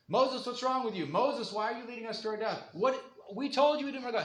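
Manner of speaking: high-pitched mock protest; yelling at Moses. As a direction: act out panicked people hounding their leader. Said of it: "they're yelling at Moses".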